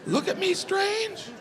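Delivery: high, anxious inner questioning; panicked self-doubt. Retold or imitated. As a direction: panic over saying it wrong. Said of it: "going through every conversation".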